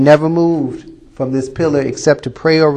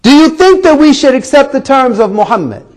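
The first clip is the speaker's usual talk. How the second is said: raised, urgent appeal; pleading; 8 semitones higher, 4 dB louder than usual. A narrator's reading. Desperate tribe asking their liaison for advice, pleading. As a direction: appeal to a trusted go-between. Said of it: "they pleaded".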